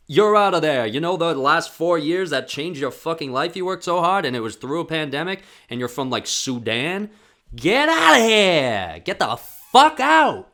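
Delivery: high, loud, brutal official dismissal; mocking harsh official. Vlogger mocking officials disqualifying an athlete, loud sneering voice. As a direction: ham up the rude official kicking someone out. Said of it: "and then they're like".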